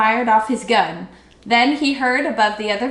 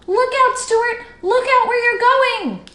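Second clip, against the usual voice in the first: urgent warning shout; 14 semitones higher, 5 dB louder than usual. A narrator's reading. Warning shouted across the pond.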